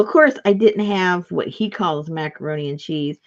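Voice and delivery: normal talking voice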